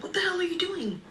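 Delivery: high, loud mock yell at a stranger; angry yell. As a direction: snap it out like you just got bumped. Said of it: "He yelled".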